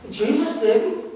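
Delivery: ordinary delivery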